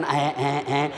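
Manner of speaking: low, soft stammering ums; mock fumbling MP. Mocking an MP stuck mid-speech, low fumbling. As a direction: hem and haw, lost for words.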